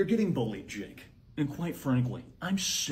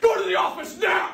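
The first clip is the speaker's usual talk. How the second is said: higher, louder order; furious shout; 7 dB louder than usual. Acted. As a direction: shout the command at full force. Principal orders student away.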